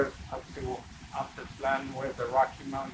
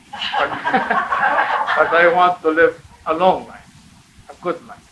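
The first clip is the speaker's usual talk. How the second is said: loud laughing through words; laughing while talking; 3 semitones higher, 13 dB louder than usual. Real, not acted. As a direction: laugh out the line. Teller laughs telling wish.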